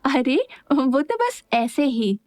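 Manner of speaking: mildly amused, drawn-out excuse; suppressing a laugh. A narrator's reading. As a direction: brush it off, lightly amused. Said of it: "अपनी हंसी रोकते हुए बोली".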